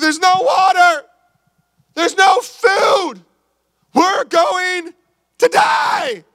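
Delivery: high, slow panicked shout. Acted. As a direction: yell it like a castaway sure of doom. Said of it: "he's like".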